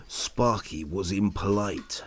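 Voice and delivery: ordinary delivery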